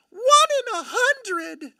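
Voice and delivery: very high, drawn-out squeal of words; thrilled, slow, high-pitched cry; amazed excitement. Real, not acted. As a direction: stretch it out high and loud in amazement. Repeats the rare hit, amazed.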